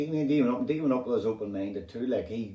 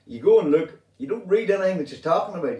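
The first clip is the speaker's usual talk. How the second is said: louder, higher, pushing the point hard; raised excited rant; emphatic outburst; 8 semitones higher, 7 dB louder than usual. Real, not acted. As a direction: hammer it home to the listeners.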